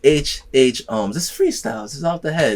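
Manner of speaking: hype intro. Acted. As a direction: hype up the freestyle start. Restarting the freestyle.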